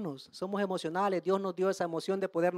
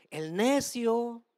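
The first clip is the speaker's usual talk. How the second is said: slow emphasis; much slower than usual. Shown as a demonstration. Slow reading of the verse.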